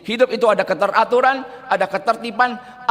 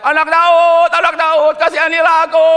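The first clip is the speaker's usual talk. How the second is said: high, loud desperate cry; raised voice begging from the roadside; blind man crying out; 8 semitones higher, 8 dB louder than usual. Retold or imitated. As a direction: call out over the crowd for mercy. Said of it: "seorang buta teriak".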